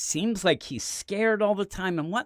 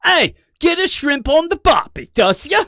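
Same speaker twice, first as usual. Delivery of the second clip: loud, high cartoon Australian; hammed-up Aussie accent; 13 semitones higher, 9 dB louder than usual. Podcaster teasing co-host with clichés, loud mock Australian accent. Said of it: "try and do an Aussie accent".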